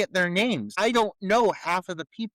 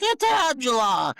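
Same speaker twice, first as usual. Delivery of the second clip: mock shout; 6 semitones higher, 4 dB louder, slower than usual. Imitating Ronnie's announcement.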